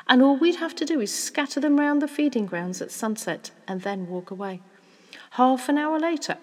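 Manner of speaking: animated father spinning a poaching plan; excited scheming father. Acted. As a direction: lay out the scheme with growing relish.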